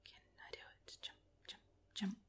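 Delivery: barely audible whisper to oneself; whispered concentration. Real, not acted. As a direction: whisper under your breath while focusing. Concentrating on a parkour jump.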